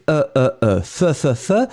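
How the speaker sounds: "euh, euh, euh, fe, fe, fe" as separate schwa sounds; vowel demonstration. Shown as a demonstration. Teacher showing how nous faisons sounds, "euh" and "fe" with a repeated schwa. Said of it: "You pronounce it like".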